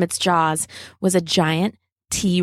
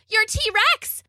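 high-pitched yell at normal volume; storyteller's contained yell, pitched way up; mock yell; slower than usual. A narrator's reading. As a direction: cry it out in a much higher voice. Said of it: "Bella yelled at Rob".

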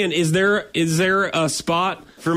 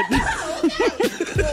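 high laugh. Real, not acted. Laughs after call.

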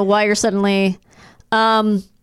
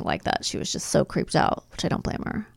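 breathy whisper, trailing off; 7 semitones lower, 10 dB quieter than usual. Real, not acted. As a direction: drop to a whisper. Trailing off quietly.

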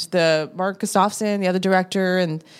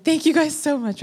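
grateful; 8 semitones higher than usual. Retold or imitated. Thanking security happily.

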